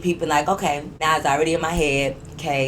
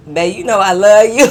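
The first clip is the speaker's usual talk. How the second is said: mock lovey-dawn; 3 semitones higher, 11 dB louder than usual. Mocking the man's comment.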